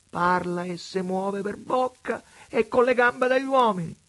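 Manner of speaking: high, loud mocking vagabond voice; vagabond Preola. A narrator's reading. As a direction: preach it like a sly tramp at God. Preola's voice in the story.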